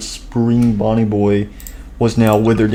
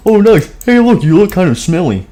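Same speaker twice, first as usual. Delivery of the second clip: high character voice; 8 semitones higher, 3 dB louder than usual. Acted.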